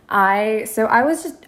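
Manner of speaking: normal talking voice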